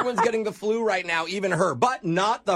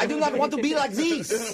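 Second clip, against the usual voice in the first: higher-pitched, pleading mock French accent; cartoonish French accent; 5 semitones higher than usual. Caller pleading with pharmacist, cartoonish French accent. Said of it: "It sounds cartoonish".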